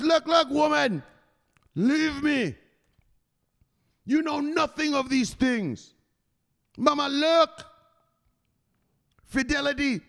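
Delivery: Michelle's gruff reply. Retold or imitated. Streamer voices Michelle brushing off his wife, high and dismissive. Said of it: "He says".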